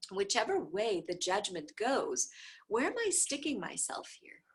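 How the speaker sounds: ordinary delivery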